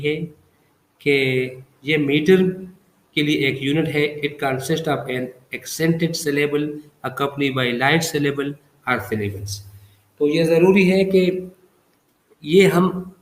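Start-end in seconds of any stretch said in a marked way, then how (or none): 4.2-9.5 slow dictation. Shown as a demonstration. Teacher dictates the definition.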